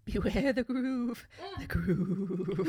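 slow, drawn-out mock ominous warning; old man's warning. Friend continuing the old man's lines, mock ominous warning. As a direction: stretch it out like a doomed old man.

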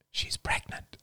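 breathy secret whisper. Acted. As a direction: whisper it so nobody hears. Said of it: "whispered Rachel".

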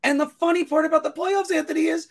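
high-pitched frustrated whine; whining voice. Real, not acted. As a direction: voice shoots up into a whine. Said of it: "prepubescent whining voice".

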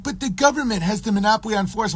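high, fast mock objection; mock protest. Captive appealing to government against his captor, mocked high protest. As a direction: rattle off the objection in a put-on high voice.

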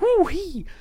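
high, loud yelp of joy; excited whoop. Real, not acted. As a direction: whoop like you're pumped. Hype after recovery.

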